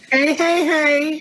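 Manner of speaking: high, held-out calls; mock attention-seeking. Friend mimics the man trying to get noticed, teasing. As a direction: pester playfully in a high voice.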